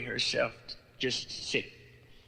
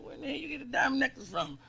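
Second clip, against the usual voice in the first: suspicious; 11 semitones higher, faster than usual. Acted. Suspicious husband questioning wife.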